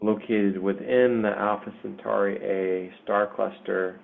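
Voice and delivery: unhurried meditation delivery, long pauses between phrases; guided meditation. Acted. Meditation leader guiding listeners through a portal to Pandora, guided meditation. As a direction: lead listeners gently through an imagined journey, leaving space. Leads a guided visualization journey.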